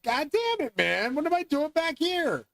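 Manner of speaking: high, exasperated cry of disbelief; exasperated outburst. Retold or imitated. Exasperated at being back.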